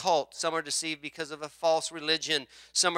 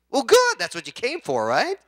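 much higher and louder, mock cheerful; bright retort; 6 semitones higher, 6 dB louder than usual. Real, not acted. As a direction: pop the answer right back. Pastor's retort to complaint.

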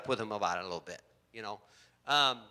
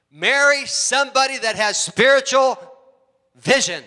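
higher and louder, pressing the point; preaching at full force; 8 semitones higher, 9 dB louder than usual. Acted. Pastor urging the single girls to marry well, forceful preaching. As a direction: hammer it home to the single girls. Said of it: "single girls, please look up here".

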